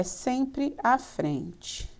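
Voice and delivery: measured, drawn-out teacherly reminder; slow emphasis. Real, not acted. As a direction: drive the rule home word by word. Teacher slowly stresses the rule.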